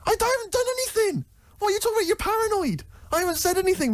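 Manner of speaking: indignant protest. Real, not acted. Protesting accusation.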